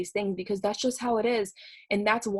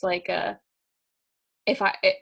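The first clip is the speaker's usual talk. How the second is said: ordinary talk, nothing marked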